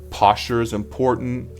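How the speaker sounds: usual speaking voice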